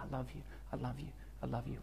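God whispering in your ear, murmured softly and tenderly; soft whispered reassurance. Pretend. God whispers to a broken believer, soft and tender. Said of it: "hear me whispering in your ear".

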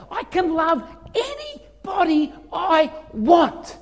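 slow, building to loud on drug addict; preacher climbing toward a climax; building preaching. Real, not acted.

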